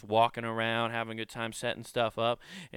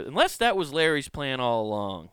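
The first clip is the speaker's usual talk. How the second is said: ordinary delivery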